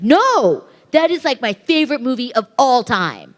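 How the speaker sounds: high, loud mock protest of a superfan; over-the-top insistence. DVD seller denying he is sarcastic, over-the-top insistence. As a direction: imitate him gushing so hard it sounds sarcastic. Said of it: "Are you being sarcastic?".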